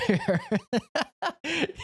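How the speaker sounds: sudden high-pitched burst of laughter; cackling at own punchline. Real, not acted. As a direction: laugh it out high and bright at your own joke.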